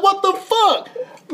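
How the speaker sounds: very high, slow, stretched-out squeal; squealing at his own sunburn photo; stunned disbelief. Retold or imitated. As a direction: drag it out in horrified shock. Seeing his two-tone sunburn.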